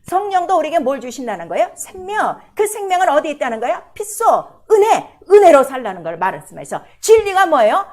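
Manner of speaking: loud, fervent preaching; preaching at full force. Acted. Pastor preaching hard to the congregation, loud and fervent. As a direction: drive it home to the congregation.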